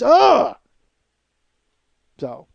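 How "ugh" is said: frustrated ugh. Acted. Frustrated groan.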